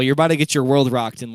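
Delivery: usual speaking voice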